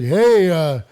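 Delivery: hammed-up old neighbour; old man voice. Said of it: "the guy's like".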